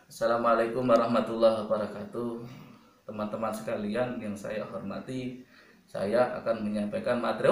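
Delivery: low, soft, unenthusiastic recital; mock a bored student's lifeless opening; lazy, listless. Shown as a demonstration. Teacher shows students how not to open a presentation, lazy and listless. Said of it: "Oh, males gitu ya".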